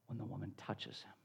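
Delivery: very low, near-whispered ending; hushed climax. Real, not acted. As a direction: drop suddenly to a hush. Hushed climax of the healing story.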